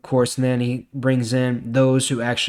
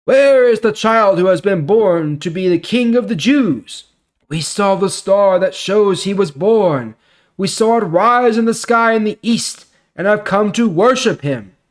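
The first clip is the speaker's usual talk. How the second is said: wise men asking, pitched higher and louder; excited asking, raised and bright; eager questioning; 8 semitones higher, 6 dB louder than usual. Acted. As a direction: travellers searching eagerly for the newborn king. Wise men ask about newborn king.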